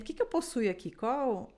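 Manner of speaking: ordinary talk, nothing marked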